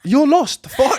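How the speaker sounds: high, loud cry of exasperation; exasperated shout. Real, not acted. Host giving up on co-host, exasperated shout. Exasperated judgement in heated argument.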